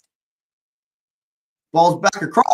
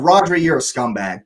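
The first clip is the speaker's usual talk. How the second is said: usual speaking voice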